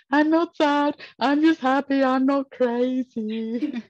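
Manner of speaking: high, loud tearful protest, imitated; hammed-up wail, dragging out the last words; mock crying. Patient insisting to her surgeon she is fine, mock crying. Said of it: "started crying".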